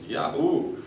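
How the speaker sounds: high gleeful whoop, mildly imitated; excited whoop. Realtor cheering to the teacher over the windfall, gleeful whoop. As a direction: cheer like you just won money.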